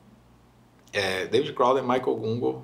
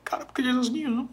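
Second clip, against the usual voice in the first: high, softened mock-weepy answer; imitated tearful reply; 10 semitones higher, 4 dB quieter than usual. Retelling his answer to his wife, mock tearful. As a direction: answer as if choked up, playfully. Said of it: "Por que que você tá chorando?".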